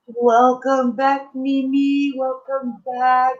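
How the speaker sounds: high, slow, bright greeting; excited welcome. Real, not acted. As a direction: sing out the welcome. Greeting returning viewer warmly.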